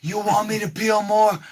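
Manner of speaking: loud, high, drawn-out protest; hammed-up exasperated complaint; mock overwhelmed. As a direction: imitate him wailing in disbelief, slowly. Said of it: "he's gonna go".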